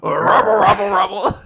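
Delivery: loud garbled villain gibberish; goofy mock-villain rumble; badly faked killer voice, loud mumbo-jumbo; Jigsaw. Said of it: "this is what Jigsaw sounds like".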